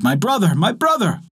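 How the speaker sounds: high-pitched searching call; crying out. A narrator's reading. Hunter cries out for his brother, high urgent call. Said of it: "he cried".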